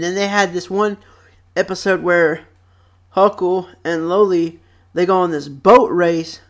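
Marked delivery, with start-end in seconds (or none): none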